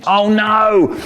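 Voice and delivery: loud, high alarmed yelp; sudden dread; panicked cry. Acted. Police arriving, panic.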